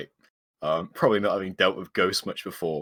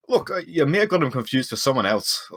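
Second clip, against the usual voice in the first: higher, halting attempt to placate; unsure dwarf reasoning with a ghost; awkward, pitched-up appeal; 6 semitones higher, 3 dB louder than usual. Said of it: "awkwardly sort of raises both hands".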